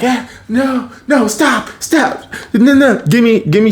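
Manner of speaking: high, urgent scolding protest; retold frantic demands. Carly stops the two fighters, frantic.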